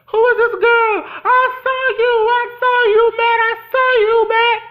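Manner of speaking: high, loud sobbing accusation, voice cracking; hysterical crying scream. Retold or imitated. Shelby screaming and crying at Matt, sobbing shriek. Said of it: "Shelby's like".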